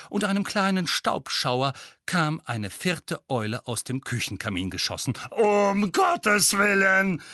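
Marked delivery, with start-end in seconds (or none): 5.3-7.2 very slow, high, loud cry; exasperated shout. A narrator's reading. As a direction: wail at the ceiling. Owl bursts in.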